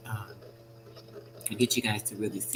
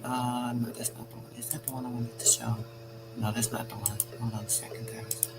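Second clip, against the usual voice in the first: headache; 8 dB quieter than usual. Real, not acted. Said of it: "I'm not feeling too great".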